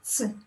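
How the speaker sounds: ts, a quick t into a hiss. Shown as a demonstration. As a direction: snap ts out as one sound. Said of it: "produces sound Z in Latin words and".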